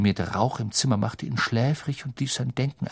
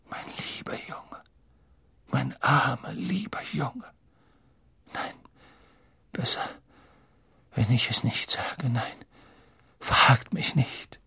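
breathy near-whisper, halting with long pauses; stricken, ominous Trelawney; 5 dB quieter than usual. Acted. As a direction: falter as if too shaken to tell. Trelawney feigns stricken dread. (Trelawney, hauchend und stockend)

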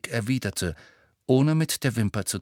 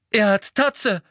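much higher, louder cry; urgent call; 10 semitones higher, 6 dB louder than usual. A narrator's reading. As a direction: shout after Snape before he leaves. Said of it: "rief er". (verzweifelt gerufen)

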